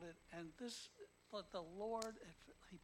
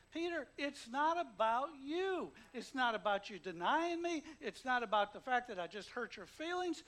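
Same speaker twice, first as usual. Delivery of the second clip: urgent appeal; 4 semitones higher, 10 dB louder than usual. Retold or imitated. Urgent rebuke in Jesus' voice.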